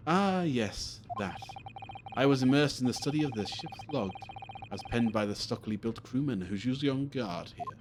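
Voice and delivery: grand, erudite sleuth explaining his study; theatrical detective. Acted. Detective explaining what he was examining, grandly theatrical. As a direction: expound like a great detective. Plays Sholmes explaining the log.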